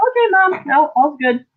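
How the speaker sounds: indulgent "okay, Mom"; family voice. Retold or imitated. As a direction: humor her like a patient teen. Family humoring her.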